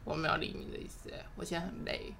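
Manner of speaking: tired, whispered brush-off; weary whisper. Retold or imitated. Friend imitating her own worn-out brush-off to the trainer, whispered. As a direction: breathe it out, too tired to care. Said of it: "截然不同的疯法".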